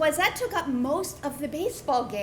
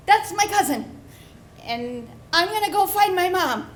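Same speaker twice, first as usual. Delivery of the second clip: put-on higher and louder panicked kid; 5 semitones higher, 5 dB louder than usual. Eleven-year-old to the security guard, panicked and higher. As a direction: blurt it out to the guard.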